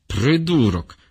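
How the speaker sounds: ordinary delivery, nothing marked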